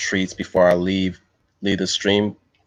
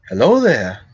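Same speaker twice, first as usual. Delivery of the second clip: higher Jedi greeting impression; Obi-Wan; 4 semitones higher than usual. Streamer demonstrating a Jedi greeting, Obi-Wan voice. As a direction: greet in a lifted, courtly Jedi voice. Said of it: "I did my Obi-Wan voice".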